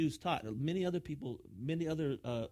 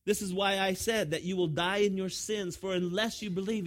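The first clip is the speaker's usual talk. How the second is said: louder, raised scripture declamation; emphatic warning; 6 semitones higher, 6 dB louder than usual. Acted. Preacher reads Jesus warning the crowd again, emphatic warning. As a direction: read it out as a pressing warning. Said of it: "he's warning them".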